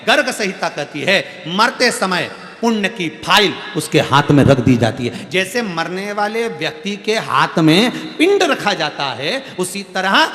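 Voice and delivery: loud, ringing sermon delivery; full-force preaching. Acted. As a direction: preach it out to the crowd with fervour.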